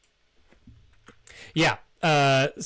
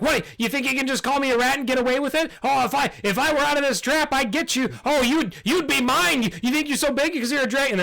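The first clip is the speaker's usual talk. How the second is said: high, fast, squeaky threats; a tiny bat yelling big threats up high; squeaky bat shouting; 13 semitones higher, 4 dB louder, faster than usual. Acted. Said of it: "Squeakers is shouting at him".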